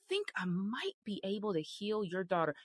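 hushed unsure whisper, mocking a doubtful Jesus; mock timid whisper. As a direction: whisper it like you hardly dare promise. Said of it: "Jesus didn't say".

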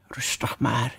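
soft, low hushing near-whisper; quiet, calming murmur; soothing. A narrator's reading. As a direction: hush them gently. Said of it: "zei Alice op sussende toon".

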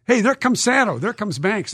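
lightly acted call to the fans; mock calling out. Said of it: "and, and go to the fans".

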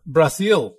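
playful foreign-style Brazil with falling end; Portuguese-style pronunciation. Pretend. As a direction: echo Brazil the local way, "Bra-SEEL". Echoes country in its own pronunciation.

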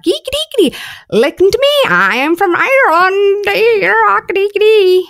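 very high, squealed mock Irish accent; fake Irish accent. Imagining waking from a stroke with a foreign accent, mock Irish. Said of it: "talk in a foreign accent ... tomorrow I'm like".

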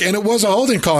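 imitating pleased brother. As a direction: lightly mimic a cheerful claim. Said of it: "And he's like".

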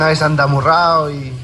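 ordinary delivery

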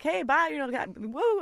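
fans yelling. Retold or imitated. Fans leaving the game, a mock cheer. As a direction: imitate a passing shout.

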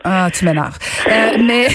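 laughing in spite of herself. Real, not acted. Teased by her co-host.

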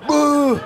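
high, loud bubbling boo; mock underwater noise. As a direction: imitate blowing bubbles under water. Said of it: "he goes".

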